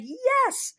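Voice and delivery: high, loud reenacted "yes" of relief; elated cheer. Retelling relief that the bird is gone, elated. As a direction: burst out with a triumphant cry.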